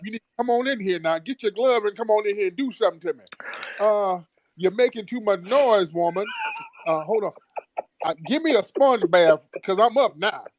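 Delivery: put-on high, grumbling elderly patient; cranky old man voice. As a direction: whine like a bossy old invalid. S0 acts a demanding old patient.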